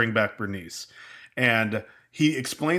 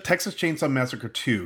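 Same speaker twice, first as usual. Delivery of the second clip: usual speaking voice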